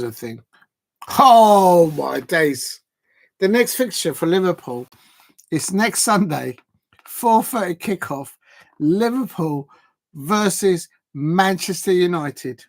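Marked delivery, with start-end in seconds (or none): 1.2-2.8 slow, loud drawn-out oh my days; shocked exclamation. Real, not acted. Football vlogger shocked at Liverpool's next fixture, loud and drawn out. 3.4-12.6 slow, weighty reveal with a louder voice; dramatic announcement. Real, not acted. Vlogger reveals the big Sunday fixture to viewers, slow and weighty.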